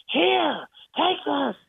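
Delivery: high, sharp, disgusted outburst; bitter high-pitched snap; retold angry shove. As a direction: fling the words like the tape recorder. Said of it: "yelling at me".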